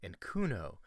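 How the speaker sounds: low, soft movie trailer narrator. Shown as a demonstration. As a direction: read it like a dramatic movie trailer. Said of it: "I'm gonna do, like, a trailer voice".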